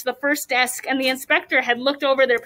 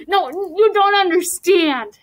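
higher, slow, insistent plea; urgent protest; 5 semitones higher, 4 dB louder, slower than usual. A narrator's reading. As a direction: stretch it out in protest. Boy protests in read-aloud story.

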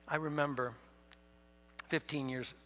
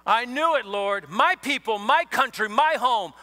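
higher and louder, indignant complaint to the Lord; angry Jonah; 4 semitones higher, 6 dB louder than usual. Acted. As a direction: spit out each "my" like a grievance. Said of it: "That angry Jonah I'm doing here".

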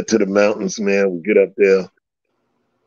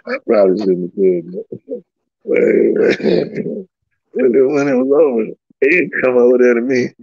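ordinary delivery

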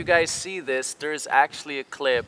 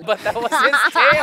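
high, loud, cracking up while talking; real laughter; 10 semitones higher, 7 dB louder than usual. Real, not acted. Host reacting to the tail clip, laughing hard. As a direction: squeal it out through real laughter.

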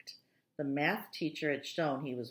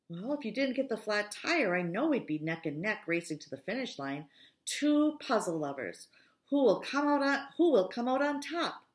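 eager, rising excitement; contained excitement; 6 semitones higher, 6 dB louder than usual. Acted. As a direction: hype up the head-to-head race. Brendan eager about the race.

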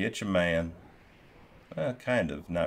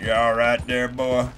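louder mock cowboy check-in; imitating cowboy; 4 semitones higher, 4 dB louder than usual. Streamer parroting the game character to the horse, mock cowboy. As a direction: echo the game's cowboy to his horse, a touch louder. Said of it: "repeats the game character".

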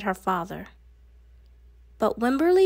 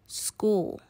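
usual speaking voice

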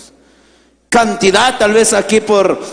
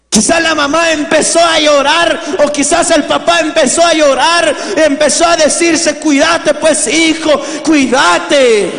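raised, higher preaching voice; impassioned preaching; 8 semitones higher than usual. Acted. Preacher tells the congregation of migrant sons, impassioned preaching.

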